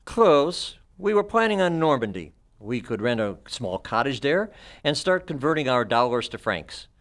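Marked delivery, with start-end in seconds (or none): 0.0-2.3 higher, louder smug boast; smug gloating. Acted. Doctor boasting to the detective, higher smug gloat. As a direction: savour the plan, pleased with yourself. Villain gloats over his plan.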